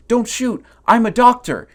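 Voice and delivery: hammed-up terrified pleading; panicked plea. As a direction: squeal it with a gun pointed at you. Man pleads with gun aimed.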